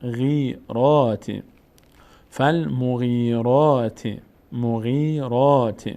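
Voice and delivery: each syllable drawn out, pause between; slow syllable-by-syllable reading. Shown as a demonstration. Teacher models exercise words for students, slow syllable-by-syllable reading. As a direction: sound it out slowly for learners to repeat.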